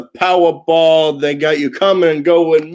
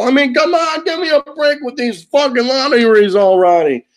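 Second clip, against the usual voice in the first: usual speaking voice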